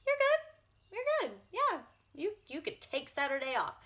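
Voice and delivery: high-pitched, bright pep talk to herself in the mirror; encouraging self-talk. Retold or imitated. To her own mirror reflection, a high, gushing pep talk. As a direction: cheer yourself on like a proud friend. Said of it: "look in the mirror and be like".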